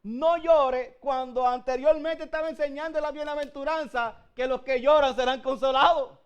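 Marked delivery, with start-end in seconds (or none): 0.0-1.0 loud firm no llore; firm command. Retold or imitated. Preacher voices Jesus telling the widow, loud and firm. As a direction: order the grieving mother to stop. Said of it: "¿Cómo es posible que Jesús le esté diciendo".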